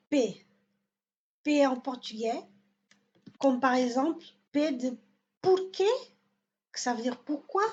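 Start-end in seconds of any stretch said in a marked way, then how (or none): 5.4-5.9 porquê pitched high, landing on QUÊ; final-syllable stress. Shown as a demonstration. Teacher models porquê, stress on last syllable. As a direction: lift sharply onto the end of porquê. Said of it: "il est dans la dernière syllabe".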